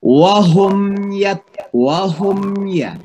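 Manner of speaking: wahum with the m said clearly before ya. Shown as a demonstration. Teacher demonstrating izhar, slow and clear. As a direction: close the lips firmly on the m of wahum. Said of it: "itu jelas".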